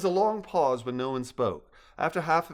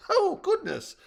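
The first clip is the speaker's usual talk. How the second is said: a high, louder squeak of surprise; startled mother squirrel exclaiming; 5 dB louder, slower than usual. Acted. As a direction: caught off guard, gasping it out. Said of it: "a startled Mama Pie exclaimed".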